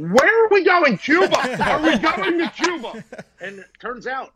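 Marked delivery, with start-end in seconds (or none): 0.0-3.0 high, loud incredulous yelp; hammed-up disbelief over the long flight; mock disbelief. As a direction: act shocked at the flight length, high and loud. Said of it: "I'm like".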